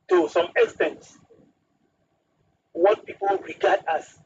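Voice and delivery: ordinary talk, nothing marked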